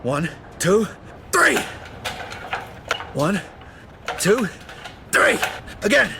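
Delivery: effortful count while pulling hard; counting through the strain of pulling. Acted. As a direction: grunt out the count as you strain. Straining to break pipe.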